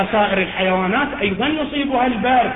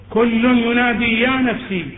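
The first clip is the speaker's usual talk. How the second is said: ordinary talk, nothing marked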